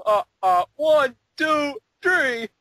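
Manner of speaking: high, loud counting. Acted. Answering the counting bit, high and loud. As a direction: shout the numbers gleefully.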